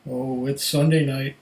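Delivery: usual speaking voice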